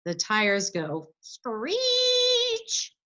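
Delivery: very slow, high tire screech; drawn-out screech. Retold or imitated. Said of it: "go around the corner too fast".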